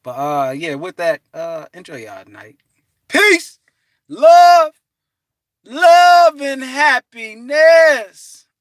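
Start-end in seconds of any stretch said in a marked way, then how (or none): 3.1-8.5 loud sign-off. Real, not acted. Sign-off blessing.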